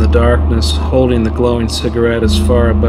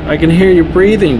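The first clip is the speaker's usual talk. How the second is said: higher, louder yell into the dark; 7 semitones higher, 4 dB louder, faster than usual. A narrator's reading. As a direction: shout it up at a hidden man. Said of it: "Bocephus yelled".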